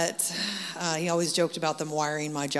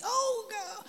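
pained yelp. Retold or imitated. Jaw pops open painfully.

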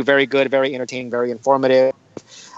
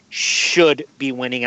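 "should" held long and leaned on; stress on SHOULD; 3 semitones lower, slower than usual. Real, not acted. Host making a Galaxy prediction, stressing "should". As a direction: hit the word "should" hard. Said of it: "I'm gonna emphasize the word should".

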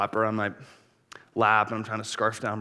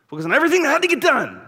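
frantic rush; 9 dB louder, faster than usual. Acted. Mocking frantic focus.

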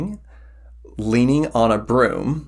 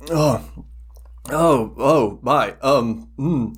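put-on startled old man; 4 semitones higher, slower than usual. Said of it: "gets a shock".

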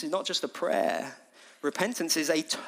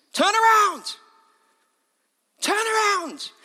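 high, repeated urgent shout; full-voiced preacher's cry; 5 dB louder, slower than usual. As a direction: yell it to turn a crowd round. Preacher calls out as John.

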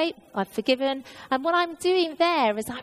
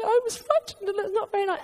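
high, tearful pleading to God; crying to God; 10 semitones higher, faster than usual. Retold or imitated. Runner telling God about the dogs, crying and high-pitched. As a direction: let the voice climb high and tearful. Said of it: "I'm crying".

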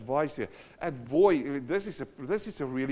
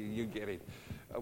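ordinary talk

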